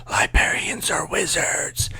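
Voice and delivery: slow hushed whisper; slow awestruck whisper. Retold or imitated. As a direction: breathe it like a secret. Hushed awe at the end.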